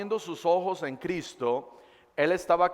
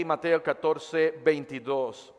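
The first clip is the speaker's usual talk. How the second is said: ordinary delivery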